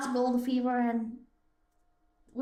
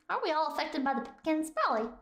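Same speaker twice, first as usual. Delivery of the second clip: ordinary delivery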